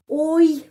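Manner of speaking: oi said slowly as one glide; oi sound. Shown as a demonstration. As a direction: stretch oi out slowly, o then i. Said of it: "bunyinya di sini oi". (bunyi oi diucapkan pelan)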